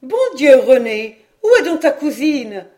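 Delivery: la mère appelle de loin, bien plus fort. Acted. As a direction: crier pour trouver sa nièce. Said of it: "s'élever la voix de madame Caudal". (Madame Caudal appelle sa fille, voix forte)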